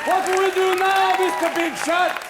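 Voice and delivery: high, loud, taunting demand; sarcastic demand. Acted. Stranded Hebrews challenging Moses at the sea, sarcastic shout. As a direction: yell it as an accusing challenge.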